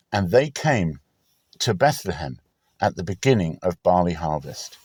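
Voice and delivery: plain reading voice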